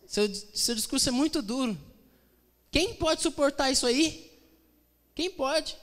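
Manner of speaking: high-pitched incredulous complaint; acted protest. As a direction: play the offended crowd, pitched well up. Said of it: "as pessoas disseram".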